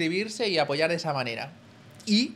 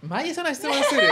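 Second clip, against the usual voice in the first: laughing protest; 11 semitones higher, 8 dB louder, faster than usual. Real, not acted. Embarrassed at a cheeky question.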